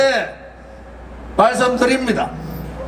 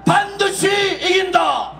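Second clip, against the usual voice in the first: roaring rallying speech; 5 semitones higher than usual. Real, not acted. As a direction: roar each slogan at the crowd. Rally closing chant of slogans.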